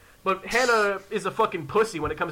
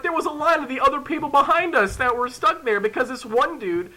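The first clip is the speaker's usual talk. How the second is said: ordinary talk, nothing marked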